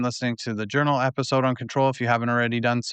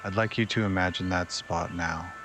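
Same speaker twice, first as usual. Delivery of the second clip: unhurried, settling into softer, lower phrases; guided meditation; 3 semitones lower, 6 dB quieter than usual. Acted.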